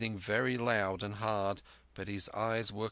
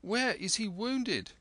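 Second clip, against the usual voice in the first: very high, pleading questions; fretful appeal for help; anxious cry; 12 semitones higher than usual. A narrator's reading. Mother and son beg the doctor for help, high and anxious. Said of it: "we cried".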